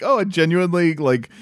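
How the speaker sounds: lightly hammed-up gush, high and laughing; mock gushing American. Imitating Americans charmed by fish and chips, high, gushing. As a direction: gush like a tourist charmed by a pub menu.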